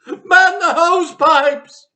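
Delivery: high loud shrieking orders; frantic wife shrieking for help; panicked cry. Acted. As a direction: yell it, slowing on the last words. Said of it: "cried Missus Cranky".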